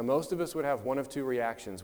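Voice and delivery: usual speaking voice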